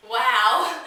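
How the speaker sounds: loud, drawn-out awe; amazed. Acted. Sixteen-year-old reacting to her first kiss, amazed. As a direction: let one word burst out in amazement.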